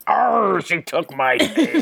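mock pirate growl, slow; a hammed-up pirate snarl; lightly put-on pirate voice; gruff pirate. Pirate voice retold in play.

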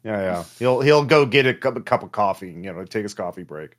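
ordinary talk, nothing marked

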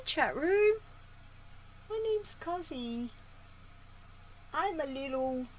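very high, soft cooing pet talk; cute anime streamer. Real, not acted. Cooing to cat, voicing it.

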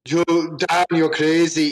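put-on jokey Austrian accent. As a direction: toss it off in a loose Austrian accent. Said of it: "this is in an Austrian accent".

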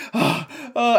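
loud, high startled gasps; imitated waking gasp. Half-orc waking mid-fall into lava, startled gasping. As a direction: gasp as if jolted awake. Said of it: "wakes up and is like".

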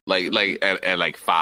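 usual speaking voice

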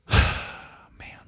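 sigh, then a soft rush of words, choked up; real choked-up emotion. Real, not acted. Dad telling his listeners he loves them, suddenly emotional. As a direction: caught off guard by feeling, softly getting it out. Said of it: "I get emotional when I say that".

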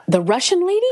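high, disbelieving question; retold high-pitched doubt; incredulous. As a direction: squeak it out in mock disbelief. Retold disbelief at getting part.

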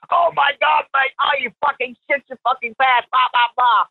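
playing the guy who thinks his friend soiled himself; mock freaked-out yell.

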